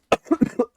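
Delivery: dry sick cough; short cough mid-sentence. Real, not acted. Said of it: "for me being a little under the weather here".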